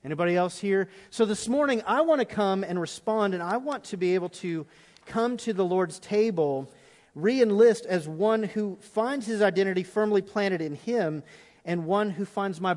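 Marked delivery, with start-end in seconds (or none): none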